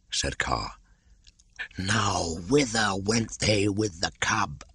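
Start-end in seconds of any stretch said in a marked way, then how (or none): 1.8-4.6 high, slithery python voice; a wary old snake, curious; a lightly hissing old python; Kaa the python. Acted. Kaa's voice in reading.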